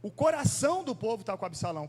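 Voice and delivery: coração punched, the point of the sermon; stress on coração. Shown as a demonstration. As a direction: hit coração to set it against povo. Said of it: "A ideia não é só que o povo está com Absalão".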